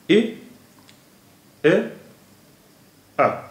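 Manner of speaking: ए, ए, अ spaced out, the first pitched high; separated vowel sounds. Shown as a demonstration. Teacher breaking essential into three vowels, separated vowel sounds. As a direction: sound out ए, ए, अ with long gaps. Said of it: "इसमें तीन vowel sounds ही हैं".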